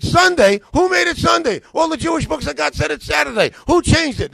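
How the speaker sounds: high, loud, exasperated demand; shouting at the church leaders in disbelief; indignant shout. Retold or imitated. Retelling how he confronted the church leaders, high indignant shout.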